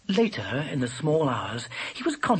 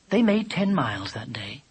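plain reading voice

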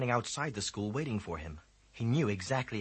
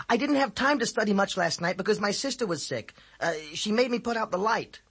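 high, loud schoolboy voice making excuses; boy's excuse; 10 semitones higher, 6 dB louder than usual. A narrator's reading. Boy excuses himself to the teacher, high and pleading. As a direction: talk your way out of trouble.

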